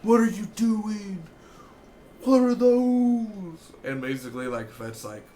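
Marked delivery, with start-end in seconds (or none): 0.0-3.7 much higher, louder, slow mocking voice; high exaggerated character yelling; hammed-up outrage. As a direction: drag out the disbelief in a high voice. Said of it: "and he's like".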